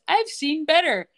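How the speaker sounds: slow, high, loud smug drawl; cocky insult delivered slowly; smug taunt. Retold or imitated. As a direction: drag it out to provoke her. Ted's stupid taunt.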